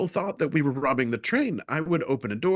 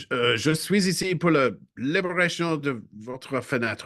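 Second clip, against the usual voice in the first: put-on clumsy English-accented French; terrible French; 3 semitones higher than usual. A traveller telling French passengers he will free their windows, in terrible French. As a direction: stumble through schoolbook French. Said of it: "I spoke to them in terrible French".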